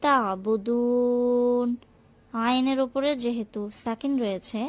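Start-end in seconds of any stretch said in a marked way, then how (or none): 0.0-1.7 তা'বুদুন with ع from the throat, vowel drawn; slow, careful তা'বুদুন; throat ain demo. Shown as a demonstration. Teacher models the throat letter, slow. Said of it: "গলা থেকে উচ্চারিত হবে".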